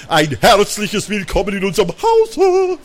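high, singsong hotel welcome; gushing receptionist. A narrator's reading. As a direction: greet regular guests effusively, rising to a held high note. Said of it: "flüsterte die Rezeptionistin".

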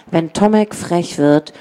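ordinary delivery, nothing marked

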